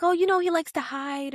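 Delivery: a hint of breezy dismissal; casual brush-off. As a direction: toss it off lightly, high. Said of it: "And he's like".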